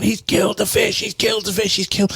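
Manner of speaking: high, panicked lament over the dead pet; distraught wailing. Retold or imitated. Older brother wailing that the fish was killed, high and frantic. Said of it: "is going".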